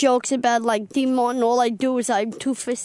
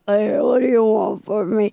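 dropping voice deep; 4 semitones lower than usual. Shown as a demonstration. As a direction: lower tough-guy voice. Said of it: "can you talk in a deeper voice".

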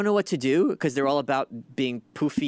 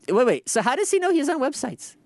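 incredulous; 9 semitones higher than usual. Real, not acted. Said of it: "What the fuck does that mean?".

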